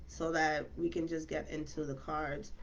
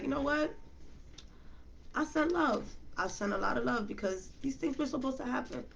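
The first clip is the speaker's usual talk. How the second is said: a lightly higher, gentle voice of forgiveness; sending love; 6 semitones higher than usual. Retold or imitated. Reader to viewers, quoting herself choosing love, light gentle lift. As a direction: say it like you choose love over anger. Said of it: "I'm just like".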